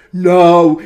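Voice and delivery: emphatic "Nou". Acted. Sheep Veronica starting her retort to the minister, emphatic. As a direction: plant your objection firmly.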